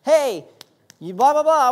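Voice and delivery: loud, high taunting call; a pest hammering at your door; sickness knocking at the door. Pretend. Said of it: "whatever it is that says".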